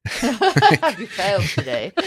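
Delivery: laughing, drawn-out mock English accent. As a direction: tease slowly in a playful English accent. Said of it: "in an English accent".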